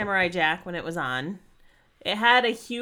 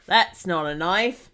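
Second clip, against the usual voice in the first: slow mock-Australian line delivery; Crocodile Dundee; 4 dB louder, slower than usual. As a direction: do a drawn-out movie-quote Aussie accent. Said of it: "with Crocodile Dundee".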